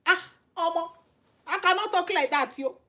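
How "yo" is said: ordinary talk, nothing marked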